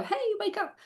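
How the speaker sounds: high-pitched mock wake-up call; rousing wake-up call. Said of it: "we don't wanna rouse them up and go".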